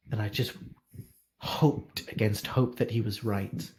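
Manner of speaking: hint of a grieving, devoted maester; sorrowful maester. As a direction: tell it heavily, weighed down by guilt. Maester's long lament.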